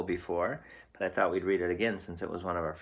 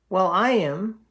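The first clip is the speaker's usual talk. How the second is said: higher, louder worried goat; frightened cry; 11 semitones higher, 9 dB louder, slower than usual. Acted. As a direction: blurt it out in alarm as the nervous goat. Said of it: "said his middle brother".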